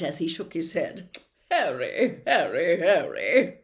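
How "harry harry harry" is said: louder, hearty showman's voice; a vain celebrity professor, loud and chummy; booming, self-satisfied charm; Lockhart voice. Acted. Lockhart tut-tutting.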